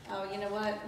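normal talking voice

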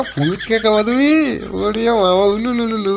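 put-on high, drawn-out cooing baby talk; high-pitched mock-doting lilt; babying a sweetheart. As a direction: coo playfully as if to a baby. Cooing to pretend sweetheart on phone.

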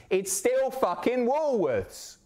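high, slow amazed disbelief; can't believe it's still there; real delighted shock; astonished. Real, not acted. Amazed at old Woolworths photo.

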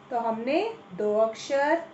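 usual speaking voice

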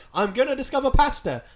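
high, loud mock-heroic boast; hammed-up explorer's declaration; grand proclamation. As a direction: announce it like an adventurer setting sail. Marco Polo's boast, acted out.

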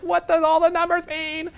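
high, slow, baffled question; confused traveller. Retold or imitated. A lost American at the station board, high and baffled.

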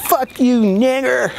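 put-on high, sneering taunt from a passing girl; imitating the cyclist's shrill insult; mocking girl's voice. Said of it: "and she's like".

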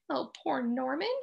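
ordinary delivery

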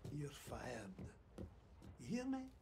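cold whisper. Acted. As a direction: breathe it with contempt. Boss fires him.

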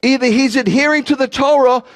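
high, exasperated preaching; full-force preaching. Real, not acted. Preacher challenging pastors and rabbis to read Scripture, exasperated full-force preaching. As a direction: hammer it home, pitched high and insistent. Preacher drives argument home.

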